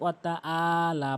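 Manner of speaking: "ওয়া তা আলা" with a plain open a for the ain; common mistake. Shown as a demonstration. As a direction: say "আলা" on an ordinary vowel, no throat squeeze. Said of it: "অধিকাংশ লোক".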